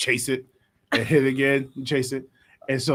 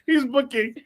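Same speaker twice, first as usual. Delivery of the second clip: ordinary talk, nothing marked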